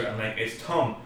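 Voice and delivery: usual speaking voice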